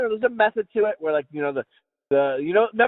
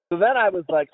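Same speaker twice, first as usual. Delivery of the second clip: ordinary talk, nothing marked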